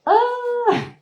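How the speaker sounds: sudden high yelp of alarm; short high alarmed scream; high startled scream. Retold or imitated. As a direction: let out a shrill shriek as whales charge. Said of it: "I'm, like, going".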